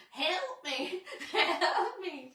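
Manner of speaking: high, loud laughing cry for help; mock plea. Clinging to Troy's arm, laughing mock plea for help. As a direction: ham up a desperate plea while giggling.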